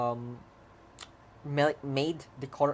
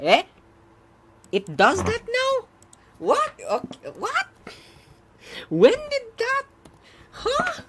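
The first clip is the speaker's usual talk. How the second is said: high, loud startled questions; can't believe what you see; shocked disbelief; 9 dB louder than usual. Real, not acted. Range output changed unexpectedly.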